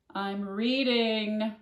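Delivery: loud, slow shout back. A narrator's reading. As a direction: call it back down, stretched. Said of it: "Flora shouted back".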